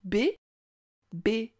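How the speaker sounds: "B, B", each syllable separate with a pause between. Shown as a demonstration. Teacher has child count sounds in bébé, syllable by syllable. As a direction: sound out "B", then "B", slowly and apart. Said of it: "Combien de fois entends-tu le son B".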